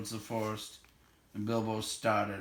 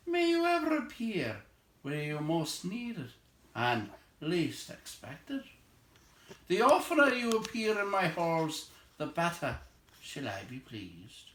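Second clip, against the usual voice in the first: elf king voice; 7 semitones higher than usual. Acted. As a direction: speak like a gracious elf lord.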